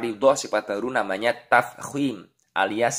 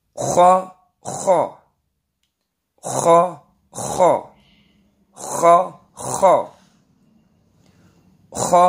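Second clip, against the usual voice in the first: higher, louder drill recitation; reading drill; 6 semitones higher, 5 dB louder, much slower than usual. Shown as a demonstration. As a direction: chant each syllable for pupils to repeat. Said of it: "Kita baca".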